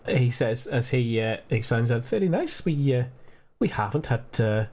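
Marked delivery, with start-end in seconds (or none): 2.0-4.7 put-on lightly Welsh-drifting old gent; Welsh-ish accent. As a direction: let the Scottish slip into Welsh. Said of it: "This has gone a bit Welsh".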